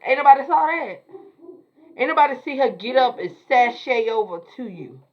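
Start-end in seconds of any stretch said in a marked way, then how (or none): none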